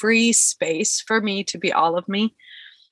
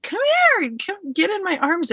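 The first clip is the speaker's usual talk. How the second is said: tender invitation; 12 semitones higher than usual. Retold or imitated. Friend invites her into her arms, tender and high. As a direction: open your arms to a crying friend. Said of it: "And she's like".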